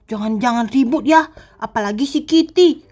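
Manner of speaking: sneak and murmur to yourself; hushed scared heroine. Acted. Sakura sneaks past zombies alone.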